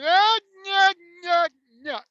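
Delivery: high, loud hammed-up nyuk nyuk; Three Stooges nyuk. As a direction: do a cartoonish Curly chuckle. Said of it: "Why is he doing the Three Stooges?".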